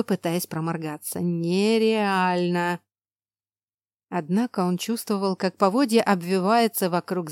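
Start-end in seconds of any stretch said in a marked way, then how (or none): 1.2-2.8 very slow hiss through teeth. Acted. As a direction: drag it out through clenched teeth. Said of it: "прошипел он сквозь зубы". (медленное шипение сквозь зубы)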